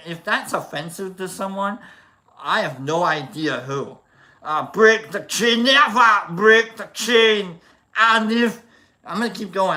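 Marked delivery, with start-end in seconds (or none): 4.7-8.6 loud, higher Arnold Schwarzenegger singing. Pretend. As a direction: sing it like Arnold, pumped up. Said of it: "We got Arnold".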